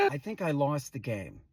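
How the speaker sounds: low, soft defeated aside; small low deadpan admission. Acted. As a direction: admit it quietly, voice dropped. Spent after the exercise.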